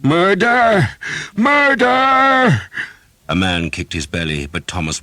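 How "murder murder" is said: very high, loud desperate scream; shrieking murder. A narrator's reading. Pinned archer shrieking for help, desperate scream. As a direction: scream for your life. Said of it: "he shrieked the alarm".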